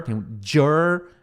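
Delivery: "jur-" held long on the English r; isolated, drawn-out "jur-"; syllable demonstration. Shown as a demonstration. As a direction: model "jur-" slowly, alone. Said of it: "é tipo assim".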